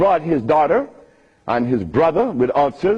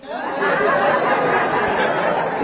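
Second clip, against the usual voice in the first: high laugh, no words. Real, not acted. Speaker laughing at his own quip to the audience, high laugh.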